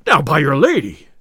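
loud, heated exclamation; hasty cry. A narrator's reading. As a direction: cry it out on impulse. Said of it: "cried the tinker hastily".

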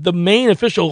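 ordinary talk

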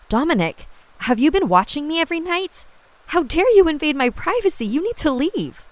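high, loud, furious outburst; angry. Acted. Casilda confronts a peeping man.

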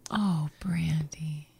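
slow, breathless disappointed whisper; shocked whisper. Acted. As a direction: gasp it out under your breath. Shocked at bottle in bag.

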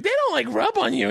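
lightly mimicked wife, pitched high; wife's voice. Comic imitating his wife about strip clubs, high-pitched. Quoting wife's naive question.

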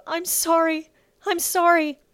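high, loud, tearful apology; sobbing. A narrator's reading. Bella apologizes as footsteps near, sobbing. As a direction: wail the apology. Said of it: "Bella began to sob".

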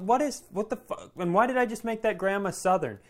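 normal talking voice

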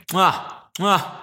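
Italian chef kisses the fingers; chef's kiss. Pretend. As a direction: double air kiss, high. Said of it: "doing the Italian thing".

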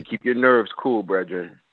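ordinary delivery

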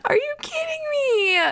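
incredulous high-pitched wail; shocked disbelief. Real, not acted. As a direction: shriek it in outraged disbelief. Shocked by the quoted line.

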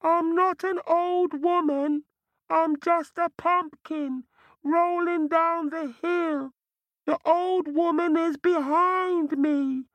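very high, innocent little voice; round hollow pumpkin voice. Acted. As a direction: squeak it like a fibbing pumpkin. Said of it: "a voice said".

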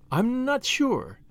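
slow, drawn-out, high-pitched uncertainty; hesitant confession. A narrator's reading. Tuppence answering Julius about the lawyer's motive, hesitantly confessing. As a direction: admit your doubt slowly, stretching the words. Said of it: "confessed Tuppence".

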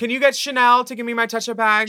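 put-on high, loud request; contestant calling for help. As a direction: imitate a queen calling out for an assistant. Imagined contestant asking for her bag.

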